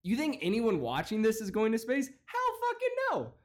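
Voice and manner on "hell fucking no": scoffing. Real, not acted. Scoffing at the viewers.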